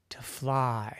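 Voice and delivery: breathy hushed wish; soft dreamy whisper of a dream. Acted. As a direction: whisper it like a precious secret.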